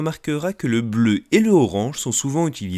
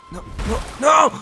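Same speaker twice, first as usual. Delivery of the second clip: high, loud acted cry; desperate protest; 13 semitones higher, 6 dB louder than usual. Thirsty character protests to the host, desperate shout.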